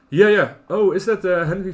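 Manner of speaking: light up as it clicks; sudden real excitement; excited recognition. Real, not acted.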